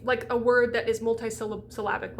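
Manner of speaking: normal talking voice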